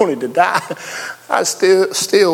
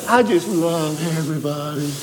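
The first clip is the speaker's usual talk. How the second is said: mock saintly; 4 dB quieter than usual. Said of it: "I don't just wake up".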